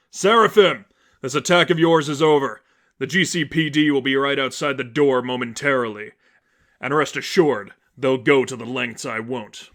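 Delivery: loud, commanding Batman; stern warning. Acted. Batman warns the Seraphim the police are coming, loud and stern. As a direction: lay down the law.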